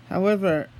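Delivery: usual speaking voice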